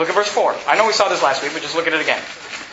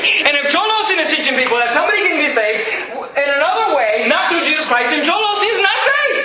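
high, forceful pulpit cry rising to the end; fired-up preaching; 5 semitones higher than usual. Real, not acted. Preacher warning the congregation about a TV preacher, fired-up preaching. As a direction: preach it with mounting intensity.

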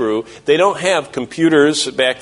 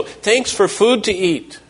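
put-on childlike prayer; 8 semitones higher, slower than usual. Child's prayer voiced by preacher.

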